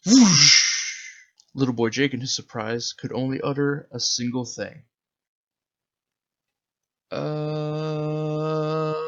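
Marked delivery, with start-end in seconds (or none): 0.1-0.6 loud rushing whoosh, higher pitched; the ship shooting off; big loud whoosh; whoosh effect. Acted. UFO takes off. 7.1-8.9 long held uh, higher; a kid lost for words; dumbfounded drawn-out uh; stunned boy. Acted.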